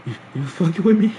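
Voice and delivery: high, strained, forced-casual question; nervous disbelief. Retold or imitated. Pressing his friend Josh if he is joking, high and nervous. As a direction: push the pitch up like you hope it's a joke. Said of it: "I start fake laughing".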